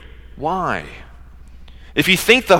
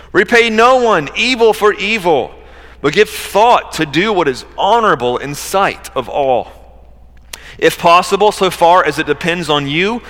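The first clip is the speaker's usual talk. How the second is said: emphatic, higher-pitched pulpit reading; scripture reading; 5 semitones higher, 4 dB louder than usual. Acted. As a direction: proclaim the verse with measured weight. Reads scripture aloud from pulpit.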